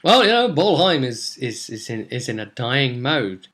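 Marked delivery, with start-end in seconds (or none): none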